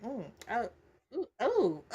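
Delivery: mock uneasy murmurs. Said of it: "looking in the mirror like".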